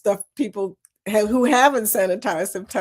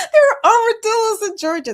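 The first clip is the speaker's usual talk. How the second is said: much higher and louder through laughter; laughing; 14 semitones higher, 5 dB louder than usual. Real, not acted. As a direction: squeal it out while laughing. Laughs at armadillo surprise.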